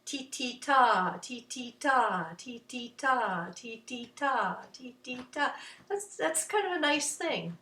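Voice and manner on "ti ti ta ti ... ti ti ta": slow short-short-long chant; lilting, looped ti-ti-ta; rhythm syllables. Shown as a demonstration. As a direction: repeat in a steady beat, holding 'ta'. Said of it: "seems a little longer".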